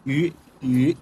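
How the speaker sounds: "ইউ" as one drawn rounded ü vowel; two-dot u sound. Shown as a demonstration. Teacher modelling dotted u for learners, "ইউ" as ü. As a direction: say "ইউ" as ee with rounded lips. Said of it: "এটার উচ্চারণ ইউ হয়".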